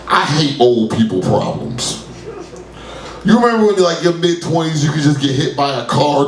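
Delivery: ordinary delivery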